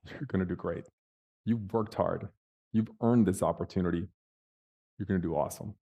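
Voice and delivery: soft, low brotherly encouragement; calm him down, quietly and unhurried; gentle, low pep talk; reassuring. Retold or imitated. Brother reassures him before interview.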